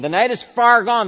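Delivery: high and loud, "far" hit hard; loud proclamation. Acted. Preacher proclaiming to the congregation, high and loud. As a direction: proclaim "The night is far gone" with full force. Climax of the sermon.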